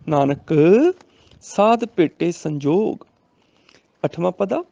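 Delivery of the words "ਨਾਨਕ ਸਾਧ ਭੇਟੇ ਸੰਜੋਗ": Nanak set apart, then the slow verse; pause after Nanak. Shown as a demonstration. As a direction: stop on Nanak as an address, then recite. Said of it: "Nanak ਤੇ ਰੁਕਣਾ ਹੈ".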